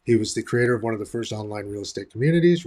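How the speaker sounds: normal talking voice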